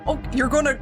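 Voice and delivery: usual speaking voice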